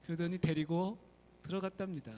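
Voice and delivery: normal talking voice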